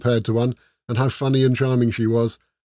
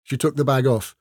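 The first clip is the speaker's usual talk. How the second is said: ordinary delivery, nothing marked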